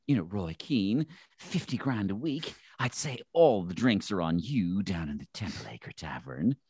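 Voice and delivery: slow, drawn-out interviewer voice; Pat Kenny. Acted. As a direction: tease the guest in a slow broadcaster's lilt. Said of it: "I remember Pat Kenny interviewing him".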